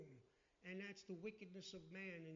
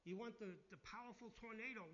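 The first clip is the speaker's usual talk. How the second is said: ordinary talk, nothing marked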